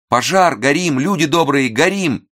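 very high cries of alarm; frantic female scream. A narrator's reading. Woman behind the door calling people to a fire, frantic screaming. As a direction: shriek for help, pitched far up. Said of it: "женский голос истошно закричал".

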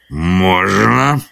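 drawn-out rasping creature reply; hoarse spirit's croak. A narrator's reading. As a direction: croak your permission slowly. Spirit in bushes croaks again.

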